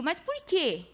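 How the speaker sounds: impassioned preaching over music. Acted. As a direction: lift into fervent preaching. Preaching voice of the Spirit.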